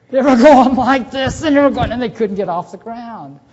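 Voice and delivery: high, loud, laughing strain of birds too full to lift off; straining stuffed robins. Retold or imitated. Teacher acting out robins for the class, high strained and laughing. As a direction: puff and strain like an overfed bird.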